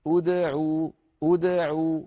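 ادعوا with lips rounded only on the همزة and عين, light bounce on the د; correct gentle demo. Shown as a demonstration. Teacher shows the correct ادعوا lightly. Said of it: "باللطف".

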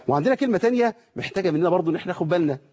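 normal talking voice

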